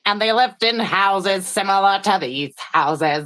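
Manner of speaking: slow, deliberate imitation of a man telling a tale; imitating a speaker. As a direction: draw the words out as an impression of the storyteller. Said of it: "He's like".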